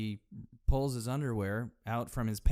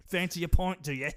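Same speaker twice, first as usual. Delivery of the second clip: mock British accent; 7 semitones higher, 5 dB louder than usual. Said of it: "British pint joke".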